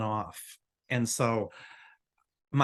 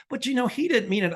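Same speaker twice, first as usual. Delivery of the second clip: higher, pleading imitation of the nurse; mock nurse voice; 11 semitones higher, 4 dB louder than usual. Imitating the doctor's nurse excusing him, high voice. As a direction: mimic the nurse making excuses, pitched up.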